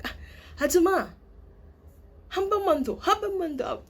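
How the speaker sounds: high, bright calling out to the auntie. Pretend. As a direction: sing out the order to the ajuma. Ordering food from a Korean auntie.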